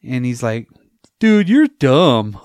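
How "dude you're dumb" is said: loud, high and very drawn-out; exaggerated slow, scoffing kid voice; mock kid's dismissal. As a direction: stretch it into a hammed-up put-down. Kid mocking the old man.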